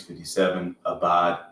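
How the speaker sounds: usual speaking voice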